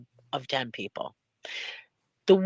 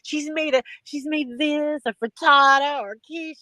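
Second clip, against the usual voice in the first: much higher, put-on voice for her envious inner thought; 10 semitones higher, 3 dB louder than usual. On the phone, imagining her friend's fancy cooking, raised, envious put-on voice. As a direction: play up your envy in a raised, put-on voice. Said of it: "I'm on the other end thinking".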